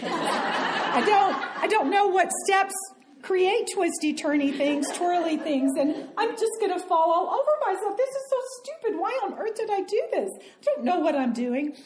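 high, laughing, rushed panic. Retold or imitated. Woman walking to the dance floor, panicking with laughter. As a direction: fret in a hurry as nerves take over.

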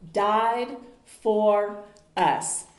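very slow, each word set apart; emphatic, drawn out. Real, not acted. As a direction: land each word slowly with weight. Climax of the preached message.

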